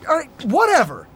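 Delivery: snapping and storming off; angry. Acted. Argument, storming off.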